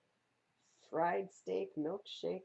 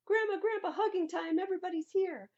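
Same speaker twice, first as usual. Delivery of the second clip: high, loud joyful call; excited greeting; 12 semitones higher, 8 dB louder than usual. Acted. Child greeting grandparents at the reunion, excited. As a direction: run to hug them.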